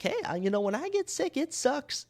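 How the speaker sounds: put-on high, whiny complaint like a sick baby. Podcaster mocking himself as a sick baby, whiny and high. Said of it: "I am honestly a baby when I'm sick".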